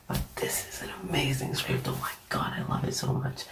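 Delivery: hushed, breath-only gushing; soft whispered enthusiasm; whispering. Real, not acted. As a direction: whisper your praise late at night. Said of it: "I'm whispering a lot".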